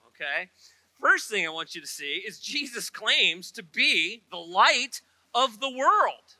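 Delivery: normal talking voice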